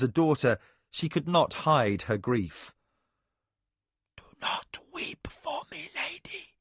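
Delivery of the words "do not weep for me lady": breathy dying whisper; barely voiced, tearful whisper. A narrator's reading. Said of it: "he whispered".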